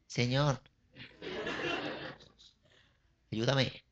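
soft, hesitant calls with long waits; timid meek calling. Pretend. Preacher mocks a blind beggar calling Jesus, timid and hushed. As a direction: try to get his attention without making a fuss. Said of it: "parecería ridículo que lo hubiera hecho así".

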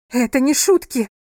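high, loud, urgent shout. A narrator's reading. Crying wife shouts at wounded husband, urgent shout. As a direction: shout it in alarm. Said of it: "крикнула она".

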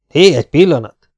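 calls out loud to cut in; loud interjection. A narrator's reading. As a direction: raise the voice to interrupt the captain. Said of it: "szólt közbe". (hangos közbekiáltás)

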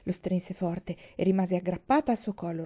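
usual speaking voice

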